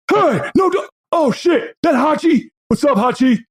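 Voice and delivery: high, excited shout of recognition; startled clerk brightening up at the dog; excited clerk greeting. Acted. Diner clerk spotting the giant dog, high and excited.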